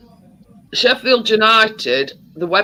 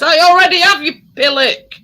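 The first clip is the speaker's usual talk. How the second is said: raised, much higher indignant comeback; retold exasperated retort; 10 semitones higher, 7 dB louder than usual. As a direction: fire it back, half-shouting and higher. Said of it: "I says".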